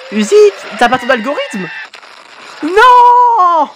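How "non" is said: excited anime fan. Real, not acted. Fan reacting to character's fate.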